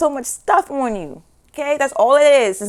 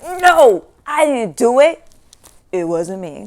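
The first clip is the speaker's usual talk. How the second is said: defensive denial; 6 dB louder than usual. Retold or imitated. Mock denial quoted.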